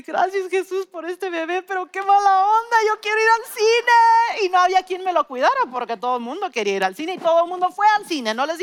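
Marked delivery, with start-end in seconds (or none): 0.0-4.4 put-on high, louder childish whine to Jesus; whiny complaint. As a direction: wail like a sulking girl who wants the movies. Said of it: "recuerdo estar llorando". (queja infantil aguda y más fuerte)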